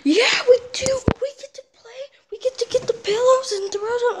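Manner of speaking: high, breathy squeal of delight; excited squeal. Real, not acted. Kid squealing excitedly about playing.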